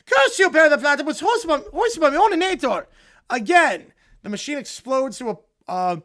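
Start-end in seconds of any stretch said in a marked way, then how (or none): 0.1-2.9 loud, hammed-up curse at the nemesis; cartoon villain defeat. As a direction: shake your fist at the platypus.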